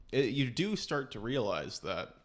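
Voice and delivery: ordinary talk, nothing marked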